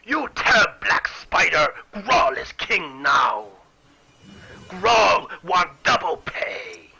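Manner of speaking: loud, slow, gruff brute bellow; gruff yell. Acted. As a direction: bellow it out slowly like a dim, gruff monster. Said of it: "that gruff voice. He, uh, yells out".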